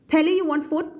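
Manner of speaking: high-pitched question with FOOD said as "foot"; mistaken question. Acted. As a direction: ask your husband brightly, FOOD cut short to "foot". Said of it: "ถ้าไปถามสามีบอกว่า".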